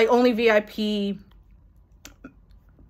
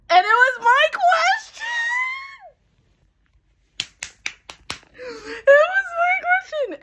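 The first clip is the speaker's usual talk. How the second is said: ecstatic shout. Real, not acted. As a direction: shout it, unable to believe it. Her question is read aloud.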